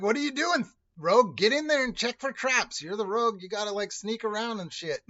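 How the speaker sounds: high, loud mock badgering; mock bossy yelling. Veteran player orders the new rogue to check traps, mock bossy yelling. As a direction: imitate a pushy player barking orders loudly.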